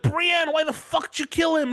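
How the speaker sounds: high-pitched panicked accusation, hammed up; mock screaming. Onlookers running in on the stabbing, mock screaming. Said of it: "everyone's screaming".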